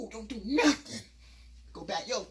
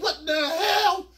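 slow, much higher, louder laughing exclaim; stunned; 8 semitones higher, 7 dB louder, much slower than usual. Retold or imitated. Narrator stunned as Zeus drives off. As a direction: stretch it out in disbelief. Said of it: "I'm like".

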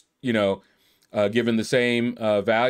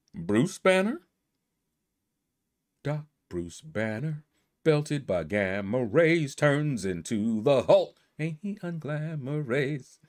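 mock movie-trailer narrator; 2 semitones higher, 4 dB quieter, slower than usual. Dramatic Hulk origin intro.

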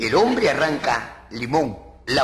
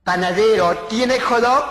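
lightly campy, raised question; high, louder, playful query; 6 semitones higher, 4 dB louder than usual. Acted. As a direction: ask like a hint of a camp customer.

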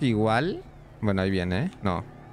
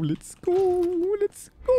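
high squealing let's go; excited squeal; much slower than usual. Real, not acted. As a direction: squeal with nervous hype. Hyping himself while grabbing item.

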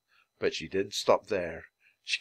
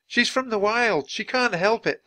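put-on high, raised girl's voice; pleading cry; 11 semitones higher, 3 dB louder than usual. A narrator's reading. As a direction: call out in the animal's defence. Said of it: "Annie cried".

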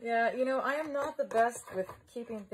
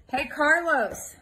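loud, higher, drawn-out call; 6 semitones higher, 9 dB louder, much slower than usual. Real, not acted. Vlogger hailing Carlos to come see, calling out. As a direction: call slowly to someone out of sight.

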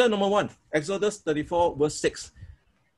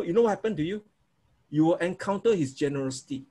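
normal talking voice

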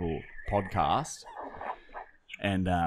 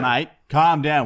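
stern cop; 7 semitones higher, 7 dB louder, slower than usual. Acted. Cop role restraining.

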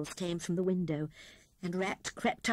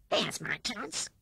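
scheming Rat; 3 semitones higher, slower than usual. A narrator's reading. Rat plots after overhearing picnic plans.

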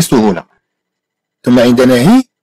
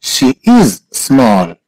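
very slow, word by word; slow model; much slower than usual. Shown as a demonstration. Teacher repeats "she is small" for a student, very slowly. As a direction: stretch "is", pause, then "small".

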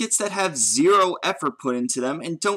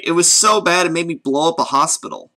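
ordinary talk

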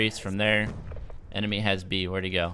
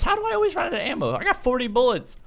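frantic panic; 11 semitones higher, 4 dB louder than usual. Real, not acted. Runs out of ammo mid fight.

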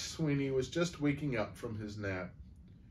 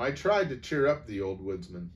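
usual speaking voice

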